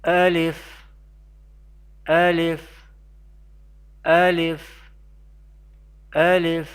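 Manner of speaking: "Alif" said alone as a clear model; letters pronounced separately. Shown as a demonstration. Teacher modelling letters for learners, "Alif" pronounced separately. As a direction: say "Alif" distinctly, pausing after each repeat. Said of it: "pronounce each letter individually".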